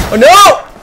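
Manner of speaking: sudden loud high oh no; alarmed. Real, not acted. Gamer reacting to a sudden attack, loud alarm. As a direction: jolt of real alarm.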